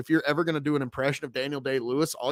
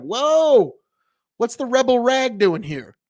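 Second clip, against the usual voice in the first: high, loud startled whoa; surprised exclamation; 8 semitones higher, 7 dB louder, slower than usual. Retold or imitated. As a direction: react like you just spotted something that shouldn't be there. Spotting Confederate flag among graves.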